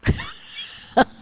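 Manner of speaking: high-pitched burst of laughter. Real, not acted. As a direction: squeal with laughter.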